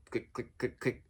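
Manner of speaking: quiet clicking noise, each click short; soft ratchet clicks. Shown as a demonstration. Explaining ratchet joints to viewers with soft clicking sounds. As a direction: mimic a ratchet softly, click by click. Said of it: "how it goes".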